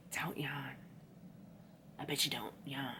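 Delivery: breathy inner-voice whisper. Retold or imitated. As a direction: hush it like a voice in your head. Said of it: "My psyche's like".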